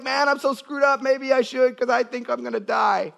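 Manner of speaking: panicked high-pitched pastor voice; imitating Pancho Juarez. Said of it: "I'm just acting like him".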